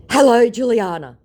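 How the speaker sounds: high, loud, hammed-up greeting; dramatic greeting. Scammer dramatically greeting Juliana, high and loud. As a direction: ham up his grand hello. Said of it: "he says dramatically".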